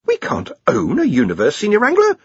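mildly scandalised protest, rising at the end; shocked. A narrator's reading. Ponder objects to the senior wrangler, mildly shocked. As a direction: object in disbelief. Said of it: "Ponder was shocked".

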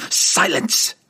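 loud snarl through gnashing teeth. Acted. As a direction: bark it through clenched teeth. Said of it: "Verna snarled through gnashing teeth".

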